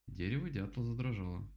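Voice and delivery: ordinary delivery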